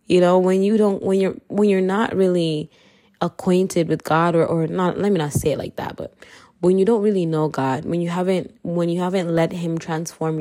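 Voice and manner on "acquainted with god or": ordinary talk, nothing marked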